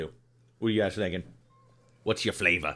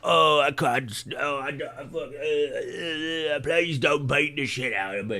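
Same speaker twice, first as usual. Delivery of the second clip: pitched-up, cowering Cockney goblin begging; 6 semitones higher than usual. Shown as a demonstration. Streamer showing chat how to voice a goblin, cowering Cockney goblin. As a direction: grovel like a scared little goblin with a Cockney twang. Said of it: "it's the Cockney accent".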